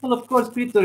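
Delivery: put-on slipping into a Russian accent. Streamer replying about Peter, slipping into a Russian accent. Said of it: "now I'm doing a damn Russian accent".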